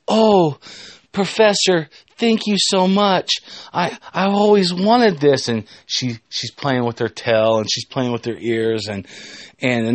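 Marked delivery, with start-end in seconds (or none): 0.1-5.5 high, gushing mock gratitude; gushing thanks. Student thanks the professor, acted high gushing thanks. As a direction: gush in a put-on higher voice. Cat girl gushes her thanks.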